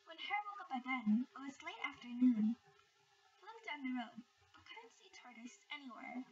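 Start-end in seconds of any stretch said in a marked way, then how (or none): none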